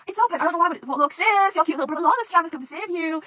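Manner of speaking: high, loud, eager announcement; excited rescuer. Acted. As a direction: burst out proudly to your sister.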